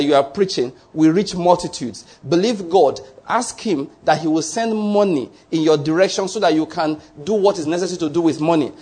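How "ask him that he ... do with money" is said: ordinary talk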